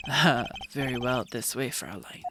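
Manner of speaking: low, smooth ladykiller voice; self-assured, teasing low voice; suave flirt. Acted. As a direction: purr it like a vain charmer. Gavin leads her in.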